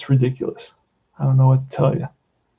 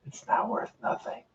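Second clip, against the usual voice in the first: breathy whispered aside, much softer; 14 dB quieter than usual. Real, not acted. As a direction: drop to a whisper for the punchline. Bitter aside about set value.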